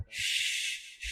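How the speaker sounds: a hushed, long "ش-" hiss, the sh sound alone; the sh sound held on its own; sh sound demo. Shown as a demonstration. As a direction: breathe out a soft "ش-" with no voice. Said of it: "شین کی آواز آتی ہے".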